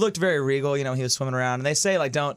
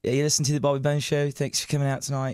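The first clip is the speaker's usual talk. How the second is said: lightly put-on British accent; radio intro in an uncertain British accent; faster than usual. Shown as a demonstration. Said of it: "Shay does a pretty good British voice".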